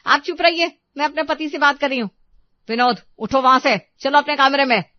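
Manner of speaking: high, very loud angry command; furious ordering. Acted. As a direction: silence the in-law and order the husband off. Wife silences mother-in-law, orders husband. (चिल्लाकर हुक्म देती पत्नी)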